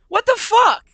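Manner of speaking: high, loud startled yelp; startled disbelief. Real, not acted. Player thrown by the game, startled disbelief. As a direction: swear in sudden high-pitched shock.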